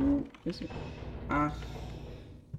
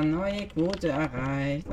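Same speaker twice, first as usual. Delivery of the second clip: ordinary talk, nothing marked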